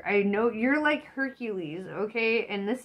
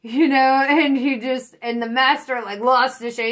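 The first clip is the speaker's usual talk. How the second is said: much louder, higher, laughing through it; can't hold the laughter in; excited laughing; 4 semitones higher, 11 dB louder than usual. Real, not acted. Telling the viewer the master's meltdown, loud laughing excitement.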